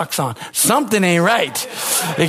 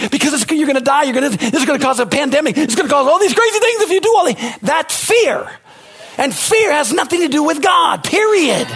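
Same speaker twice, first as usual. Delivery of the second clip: preaching at full force; 7 semitones higher than usual. Acted. Pastor warning the congregation against fear, preaching hard. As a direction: hammer it home from the pulpit.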